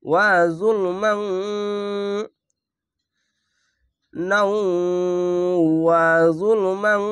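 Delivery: slow Quran recitation, নাউ and জুলমান with a held nasal hum; nasal ghunna recitation. Shown as a demonstration. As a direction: draw out ওয়া জুলমান, holding the nasal ন. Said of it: "এখানে ইকফা গুন্না বাংলা অনেস্কার মতো". (গুন্না দিয়ে টেনে তেলাওয়াত)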